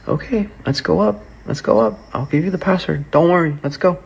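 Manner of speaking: imitating her cocky confidence, pitched well up; high-pitched mock bravado; confident badass act. Girlfriend offering to go up with the password, mock-confident. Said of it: "Says it like that, all confidently".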